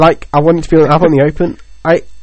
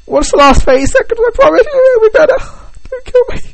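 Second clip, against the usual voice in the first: high, squeaky panicked plea for mercy; panicked begging. Pretend. To his knife-wielding girlfriend, a high mock-terrified plea. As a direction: beg for your life in a squeaky, mock-terrified voice.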